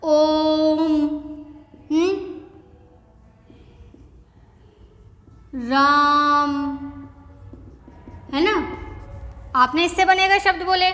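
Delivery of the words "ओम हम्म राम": ओम and राम with long stretched vowels; drawn-out vowels. Shown as a demonstration. Teacher demonstrating pluta vowels, long drawn-out sounds. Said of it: "बोल के देखते हैं".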